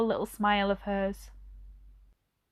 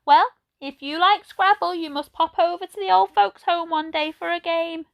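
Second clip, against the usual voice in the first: put-on old lady voice; 9 semitones higher, 5 dB louder than usual. A narrator's reading. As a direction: voice her like a gentle elderly lady. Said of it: "said Edna".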